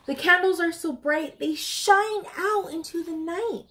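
high, slow storybook sing-song; bright, drawn-out storytime voice; reading to children. Acted. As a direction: read it like a bedtime picture book for little ones. Reads picture book to children.

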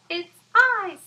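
high, loud squeaky fly; a tiny fly answering proudly. Acted. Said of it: "said the fly".

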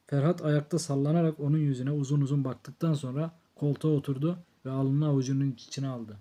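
ordinary delivery, nothing marked

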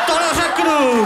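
high squeaky refusal; defiant boy. Acted. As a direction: squeal a stubborn no.

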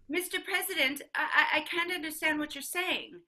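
loud, high, stammering protest; straining on the phone. Acted. Mother telling the President she can't understand, loud and high. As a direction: raise the voice over a bad connection.

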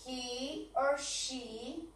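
drawn out slowly; very slow and soft. Shown as a demonstration. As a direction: stretch each word. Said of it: "O no".

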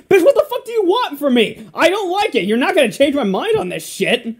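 high, loud outburst; exasperated retort. Retold or imitated. Acting out his reply to a nagging critic, exasperated retort.

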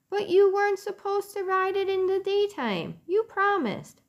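put-on high, hurt child reproaching; upset boy voice. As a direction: remind him he broke his promise. Keith upset, reminding Ralph.

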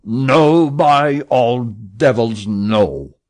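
very loud, slow raging shout; captain shouting his refusal in rage; furious refusal. A narrator's reading. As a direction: roar the refusal. Captain refuses to sell ship.

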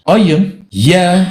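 "Ayın, ye" as separate letter names; name each letter on its own, "Ayın", then "ye"; spelled letter by letter. Shown as a demonstration. Debater spelling the written four-letter name, "Ayın, ye" letter by letter. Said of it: "Kitapta yazılı olan dört harftir".

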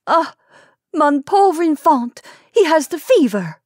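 high, loud lament; mother's wail. A narrator's reading. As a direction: wail over your sick child. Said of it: "wailed the mother".

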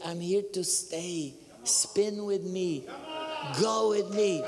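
slow, drawn-out, rising in force; quoted divine voice. Retold or imitated. God calling the seeker to spin, slow building summons. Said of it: "I heard him say".

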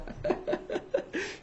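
silent wheezing laugh at his own joke; breathless laugh. Real, not acted. Coach laughing at his scam joke, breathless wheeze. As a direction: huff out a voiceless laugh.

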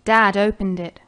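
ordinary delivery

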